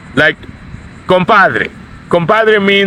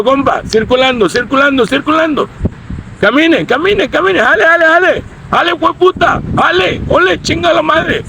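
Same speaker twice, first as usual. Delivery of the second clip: much higher, taunting pachuco rant; mock street tough; 8 semitones higher than usual. Shown as a demonstration. Street tough shooing off a rival, hammed-up taunt. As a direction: push him along, mocking and rough. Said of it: "I just gave you a little demonstration".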